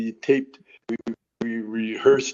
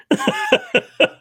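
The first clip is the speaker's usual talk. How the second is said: high laughing fit; 3 dB louder than usual. Real, not acted. Laughing at his own story.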